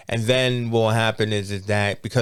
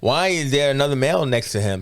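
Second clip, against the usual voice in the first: ordinary delivery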